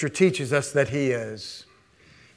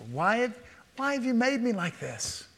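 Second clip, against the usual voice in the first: higher plaintive whine of the pot; the clay complaining; 6 semitones higher, 8 dB quieter than usual. Acted. Preacher voicing the clay protesting to the potter, high whine. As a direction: speak as the clay that talks back to its maker. Clay voiced talking back.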